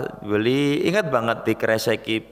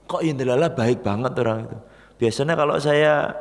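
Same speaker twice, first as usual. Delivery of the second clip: plain reading voice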